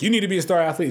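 lightly mock a demanding father; pushy father. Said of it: "that father who's just like".

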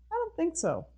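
lilting high, prim voice for the tuxedo man; haughty refusal. Acted. Tuxedo man brushing Mabel off, in a high prim voice.

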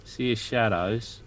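a spooked dwarf whispering-low to his friend; low hushed dwarf Leopold. Acted. Player voicing Leopold character.

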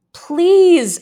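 high, loud, desperate yell; yelled plea. A narrator's reading. Girl held down, yelling a plea to be released. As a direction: yell it out, begging. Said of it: "I yelled".

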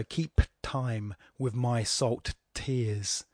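slow line, each word separated; linger, with crisp consonants and small gaps. Shown as a demonstration. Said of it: "that can slow you down".

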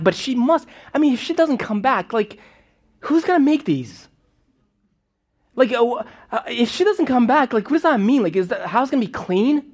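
put-on a small boy spiraling in worry; child's panic. Child's panicked thoughts about mom.

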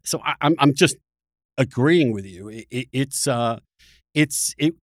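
ordinary talk, nothing marked

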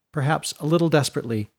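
ordinary delivery

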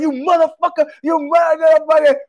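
high, strained cursing in imitation; mock furious yelling. Laughed-at stranger curses the man walking off, mock furious.

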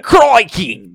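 hammed-up mock Australian; fake Australian accent. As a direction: put on an unconvincing Aussie twang. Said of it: "pretty sure that guy is not Australian".

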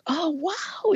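high, lifted oh wow; retold amazed little wow; surprised wow. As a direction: react as if stunned by the news. Her reaction, retold.

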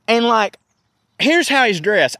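usual speaking voice

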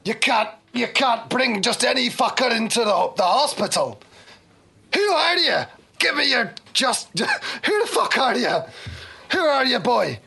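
high, heated soldier voice; challenging soldier. Acted. Soldier barring an injured stranger from hospital, heated challenge. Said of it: "a soldier steps in the way".

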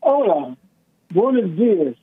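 ordinary talk, nothing marked

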